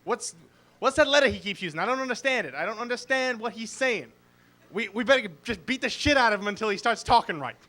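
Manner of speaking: put-on baffled Boston kids' accent, R's dropped; Boston kids. Boston kids fed up with the R-user, indignant Boston accent. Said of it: "suddenly they're all like".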